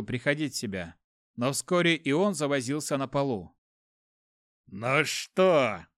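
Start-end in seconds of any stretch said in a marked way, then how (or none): 4.7-5.9 gloating. A narrator's reading. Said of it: "Мран лучился злорадством".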